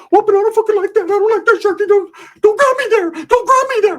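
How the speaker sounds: squealing falsetto protest; mock-protest horseplay in a very high squeal; [name].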